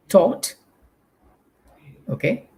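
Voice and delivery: ordinary talk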